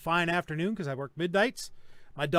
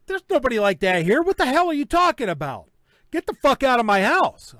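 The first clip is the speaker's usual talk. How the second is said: louder, higher retold angry rant; pissed off; 5 semitones higher, 8 dB louder than usual. Homeowner ordering marshals out of his house, retold angry shout. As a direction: throw them out of your house, fuming. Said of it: "I was pissed".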